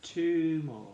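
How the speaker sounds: rally the class, full voice; loud call. Real, not acted. Teacher calls class on.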